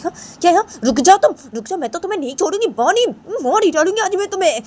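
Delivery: high, loud threatening yell at Bani; furious threat. Acted. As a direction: shriek the threat, chasing her out. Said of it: "तो रायमा वहाँ से बोलती है". (गुस्से में ऊँची आवाज़ में धमकी)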